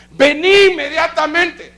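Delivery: high, slow, loud command; urgent summons. Retold or imitated. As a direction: call him up at once. Apostle summons him again.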